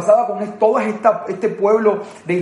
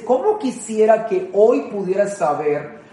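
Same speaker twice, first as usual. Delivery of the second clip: slow tender quote; slower than usual. Acted. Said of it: "y dice".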